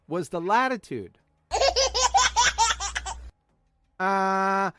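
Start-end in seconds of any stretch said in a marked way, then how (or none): 1.5-3.1 high cackling laugh; a sharp scornful cackle; mocking laugh. Real, not acted.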